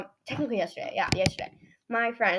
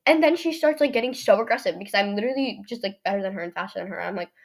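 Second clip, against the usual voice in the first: ordinary talk, nothing marked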